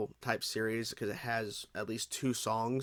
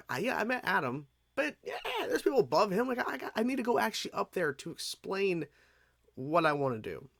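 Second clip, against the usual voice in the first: lightly imitating an eager young woman, pitched higher; higher-pitched, mildly excited retelling; 7 semitones higher than usual. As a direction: voice her eagerness, easing down toward the end. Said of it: "she's all excited".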